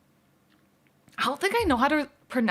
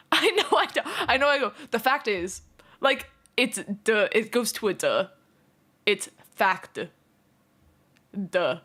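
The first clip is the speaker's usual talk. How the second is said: ordinary talk, nothing marked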